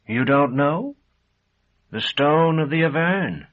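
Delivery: can't believe he doesn't know; a touch of shocked disbelief. A narrator's reading.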